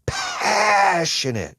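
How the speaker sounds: stretched, high, loud shout; passionate shout. Shown as a demonstration. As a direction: shout it from the chest, drawn out. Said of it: "You feel that?".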